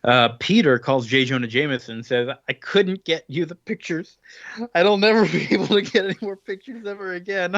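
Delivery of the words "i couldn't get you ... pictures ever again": higher, laughing mock teen whine; whiny teenager. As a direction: play the sulky kid who blew it, half-laughing. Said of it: "He's such a teenager".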